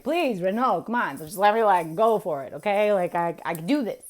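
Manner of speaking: loud, eager mock begging; impatient pleading. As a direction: plead to be let go, brashly. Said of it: "And I'm like".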